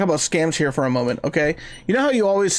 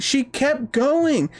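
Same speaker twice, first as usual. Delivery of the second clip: slow emphasis; 7 semitones higher, much slower than usual. Real, not acted. Amazed, drawn-out emphasis.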